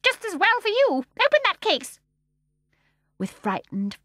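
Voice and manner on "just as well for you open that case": put-on high, loud, bossy old woman; sharp command. A narrator's reading. Mrs Corrie ordering her daughter, loud sharp command. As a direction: snap the order.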